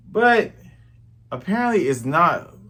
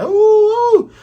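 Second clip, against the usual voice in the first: very high, loud wolf howl; mock howling; 10 dB louder than usual. Pirates howling on Don Creek's ship, loud high howls. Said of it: "and howling".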